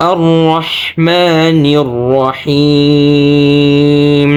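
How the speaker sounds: slow, drawn-out melodic recitation; chanted Quranic tilawat. Shown as a demonstration. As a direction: recite slowly with long held vowels.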